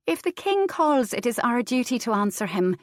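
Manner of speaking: high female voice, sing-song insistence; insistent wife. A narrator's reading. Wife coaxing husband to court, high and bright. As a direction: coax him with duty.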